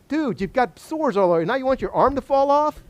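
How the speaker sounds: high, rising disbelieving question; incredulous. Acted. Preacher to Job covered in sores, incredulous high-pitched question. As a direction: climb in pitch, baffled by the request.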